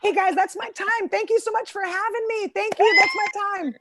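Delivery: high-pitched bright stage farewell; show sign-off. Acted. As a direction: thank the crowd in a high voice. Hurried sign-off after the punchline.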